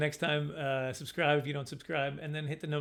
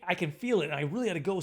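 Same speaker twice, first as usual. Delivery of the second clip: ordinary talk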